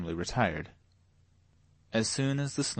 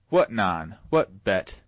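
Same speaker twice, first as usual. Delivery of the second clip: put-on sharp, louder call to the girls; loud summons; 6 dB louder, slower than usual. A narrator's reading. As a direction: yell the daughters' names across the room. Father barks at his daughters.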